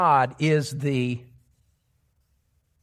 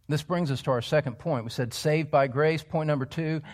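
usual speaking voice

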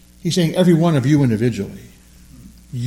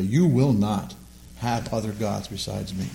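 low, softening threat on "You will not"; quietly warn, dropping away on "have other gods besides me"; understated divine warning, voice sinking; 4 semitones lower, 5 dB quieter than usual. Acted. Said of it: "It's a threat".

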